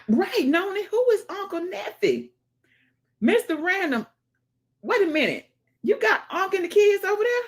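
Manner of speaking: high excited calling out. Real, not acted. Streamer calling out viewer names, high and excited. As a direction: ask it high and worked up.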